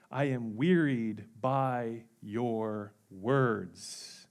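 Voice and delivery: very slow, low, soft and grave; slow grave divine lament; solemn voice of God. Acted. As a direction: intone it heavily, like God weary of them. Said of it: "And God says".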